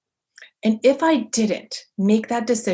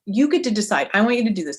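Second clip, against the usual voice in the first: ordinary talk, nothing marked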